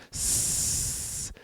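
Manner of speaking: long unvoiced hiss of the letter s; hissing s sound. Shown as a demonstration. Teacher demonstrating the voiceless fricative to students, a long hiss. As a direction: hold a hissing sss to show the voiceless fricative. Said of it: "esse som".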